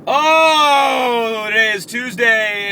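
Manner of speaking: excited exclaim. Pretend. Exclaimed opener.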